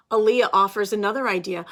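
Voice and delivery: usual speaking voice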